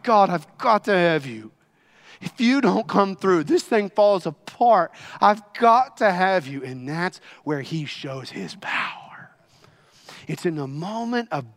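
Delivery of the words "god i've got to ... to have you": loud, insistent pleading; desperate crying out. Acted. Believer who needs God to come through, desperate crying out. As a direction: cry out in urgent need. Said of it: "Desperately pleading, desperately crying out".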